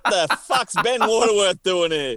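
laughing, exasperated groan at an unwanted guest; mock exasperation. Co-host pictures seeing his podcast partner arrive, laughing and exasperated. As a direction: groan as if the worst visitor walked in. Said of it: "I would have been like".

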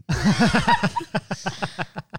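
loud, high laugh; big high-pitched cackling laughter. Real, not acted. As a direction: burst out laughing at your own punchline. Laughing at own punchline.

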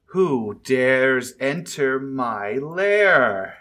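slow, imperious dragon challenge. Acted. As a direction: draw it out with menace. Dragon lands before the party.

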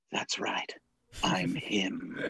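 low hushed whisper as Loki. Retold or imitated. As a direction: breathe it like a secret villain. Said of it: "Hiddleston lowers the f-, front of his head and goes".

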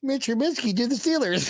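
high-pitched gloating announcement; mock intro call. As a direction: crow it as a pretend show intro. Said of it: "just be me going".

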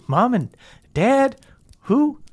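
lightly acted guilty stalling; guilty Meemaw. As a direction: play innocent haltingly, pitch climbing. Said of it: "you see she looks guilty".

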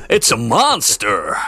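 slow, lightly hammed game voice; a hint of cheesy game dialogue, slow; quoting a cheesy game line; game quote. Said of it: "Barry Burton quotes".

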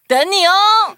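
high, loud bubbly sign-off; cheery call-out. Acted. Host calling to young listeners, high and bright. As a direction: sing it out to the kids.

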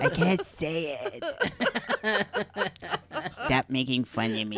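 character who can't say R's; light lisping character voice; speech impediment voice. Acted. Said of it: "Why can't you pronounce R's".

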